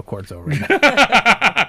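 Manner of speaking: laughing through the punchline, slow. Real, not acted. Telling friends the punchline, laughing. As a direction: crack up while delivering it.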